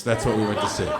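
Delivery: ordinary talk, nothing marked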